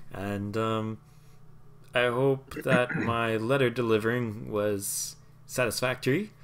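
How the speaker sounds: a nervous tiny courier fumbling his delivery; halfling courier voice. Acted. Halfling talks on.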